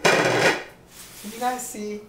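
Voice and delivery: loud, slow opening call; campy cooking-show diva; diva host call. Acted. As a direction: greet the viewers big and drawn out.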